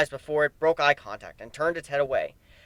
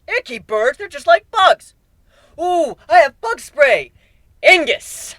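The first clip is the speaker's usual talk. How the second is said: high, loud, giddy squeal; crazed glee casting fire; manic childish glee; 14 semitones higher, 10 dB louder, slower than usual. Acted. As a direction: squeal it like a kid with a toy.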